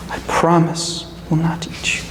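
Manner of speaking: soft whispered aside; whispered promise. A narrator's reading. Wolf promises crane not to eat it, whispered.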